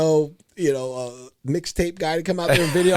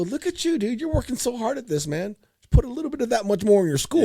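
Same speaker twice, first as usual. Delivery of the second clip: much higher, playful mock-impressed; teasing praise; 7 semitones higher than usual. Dad teasing son to work that hard at school, much higher. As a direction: lift the voice high to tease. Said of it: "And I go".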